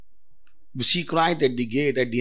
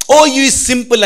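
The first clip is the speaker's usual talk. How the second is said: loud, high call out; loud preaching call; 9 semitones higher, 11 dB louder than usual. Acted. Preacher calling out to the simple, loud and high. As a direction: cry it out to the simple.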